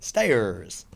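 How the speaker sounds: "Stayers" with a heavy Bristol r; low, drawn-out Bristolian "Stayers"; Bristol accent. Shown as a demonstration. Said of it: "like, a Bristolian accent".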